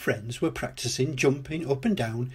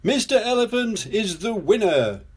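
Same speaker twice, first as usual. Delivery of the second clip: high contest announcer; announcing; 8 semitones higher than usual. A narrator's reading. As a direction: proclaim the winner, pitched high. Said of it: "announced Mister Potato".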